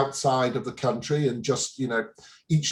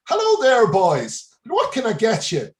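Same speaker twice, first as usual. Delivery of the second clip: put-on broad Irish accent, pitched up and loud; terrible Irish accent; 8 semitones higher, 5 dB louder than usual. Tea-room woman greeting tourists, terrible mock Irish accent. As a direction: imitate an Irish barmaid's greeting, hammed up. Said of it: "forgive the accent, I know it's terrible".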